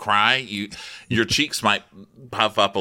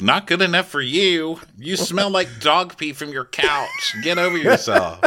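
mock indignant retort; 7 semitones higher than usual. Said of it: "I'm like".

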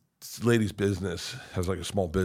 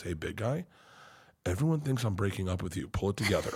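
discreet daughter; 2 semitones lower, 7 dB quieter than usual. Retold or imitated. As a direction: lean in and quietly tell him off. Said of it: "just leans in and goes".

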